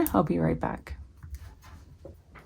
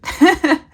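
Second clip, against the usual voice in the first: sudden bright burst of laughter; loud laugh; 10 semitones higher, 12 dB louder than usual. Real, not acted. Laughing at crooked writing.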